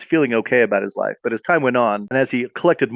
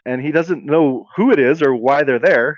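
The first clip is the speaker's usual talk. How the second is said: normal talking voice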